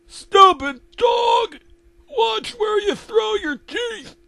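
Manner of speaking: very high, drawn-out angry shout; Eustace yelling. Acted. Eustace berating the dog about his teeth, angry yell.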